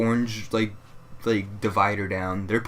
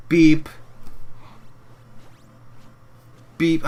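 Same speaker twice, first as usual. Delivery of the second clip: loud, higher imitated scanner beep; 6 semitones higher, 5 dB louder than usual. Cashier mimicking the register as each item scans, loud beep.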